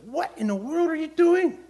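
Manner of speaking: high, loud rebuking voice; voice of the Lord. Retold or imitated. The Lord rebuking the preacher on his drive, high loud scolding. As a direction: voice the Lord scolding him, rising. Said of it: "the Lord spoke to me and said".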